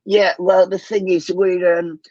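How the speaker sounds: put-on high old grandad voice; Grandad puppet voice. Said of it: "during the pandemic".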